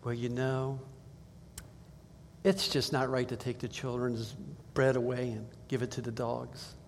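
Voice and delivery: "it's just not right" said softly, kindly; gentle, soft version. Shown as a demonstration. Preacher voicing Jesus's gentle rebuke, soft and kind.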